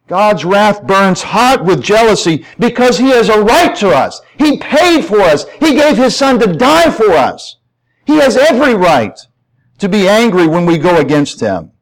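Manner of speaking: high, driving pulpit voice; impassioned preaching. Real, not acted. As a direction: preach it hard, punching key words. Preaches at full force.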